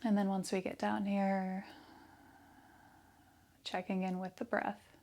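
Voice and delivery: soft, hushed meditation voice with long pauses; guided meditation. Real, not acted. Yoga teacher leading breath awareness and affirmations, soft meditation voice. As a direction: guide them softly into stillness, leaving space after each phrase.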